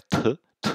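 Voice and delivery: "t" as a little burst of air; voiceless explosive "t"; aspirated T. Shown as a demonstration. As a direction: say "t" as a puff of breath, no voice. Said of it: "une petite explosion d'air".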